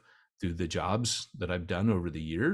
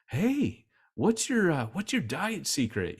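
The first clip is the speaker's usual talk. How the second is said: bright, much higher quizzing voice; eager curious asker; 9 semitones higher than usual. Retold or imitated. People back in America keenly asking his diet secret, high eager voice. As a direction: play the nosy friend wanting the secret.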